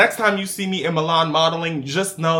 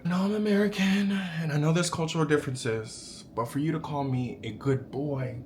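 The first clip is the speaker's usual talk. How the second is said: ordinary talk, nothing marked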